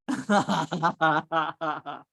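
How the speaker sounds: loud, bubbly run of laughter; hearty laugh. Real, not acted. Amused aside about YouTube.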